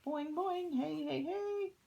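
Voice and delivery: put-on boing it out like a pesky kid; noisy kid. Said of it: "He's going".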